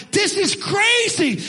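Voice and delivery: drawn-out, high exasperated cry; slow and high, fed up with it all. Retold or imitated. Exasperated over the stuck hose.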